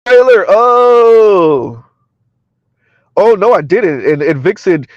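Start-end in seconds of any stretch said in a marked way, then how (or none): 0.1-1.8 loud, long drawn-out oh; sudden surprised outburst; surprised realization. Real, not acted. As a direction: react to sudden news, louder and stretched. Reacting to news of a trailer.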